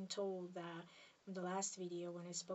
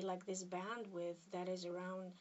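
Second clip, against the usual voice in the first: normal talking voice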